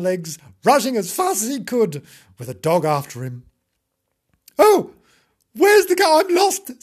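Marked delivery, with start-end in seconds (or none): 4.6-6.8 very high, loud wail; lost kitten. Acted. Kitten rushing away from a dog, lost and distressed. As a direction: cry out in hammed-up distress.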